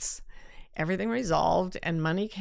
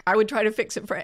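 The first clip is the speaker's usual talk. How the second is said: usual speaking voice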